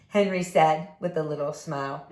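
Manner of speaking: plain reading voice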